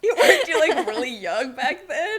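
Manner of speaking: high, laughing question. Real, not acted. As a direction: giggle through it at your own mistake. Host cracks up at own confusion.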